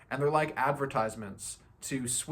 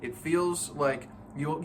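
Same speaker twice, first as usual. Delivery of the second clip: normal talking voice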